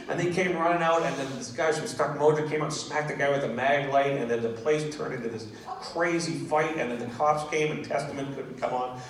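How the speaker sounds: ordinary talk, nothing marked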